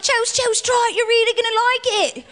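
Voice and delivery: hammed-up sleazy coaxing; mock boyfriend. Comic mimicking ex-boyfriend pushing threesomes, mocking high coax. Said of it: "He's like".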